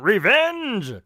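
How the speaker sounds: loud, high mock battle cry; yelling revenge. Vulture and Lupex charging in, loud mock battle cry. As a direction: shout it like a war cry. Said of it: "Vulture and Lupex yelling".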